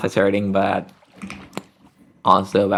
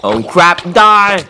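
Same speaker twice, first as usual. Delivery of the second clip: loud, high battle yell; yelling in a fight; 9 semitones higher, 7 dB louder, much slower than usual. Real, not acted. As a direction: shout it at the monster. Fighting a mob in game.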